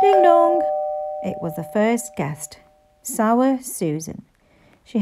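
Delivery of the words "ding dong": high, loud sung doorbell chime; doorbell ding dong. A narrator's reading. Narrator imitating the doorbell, high and loud. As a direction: ring it out like a bell.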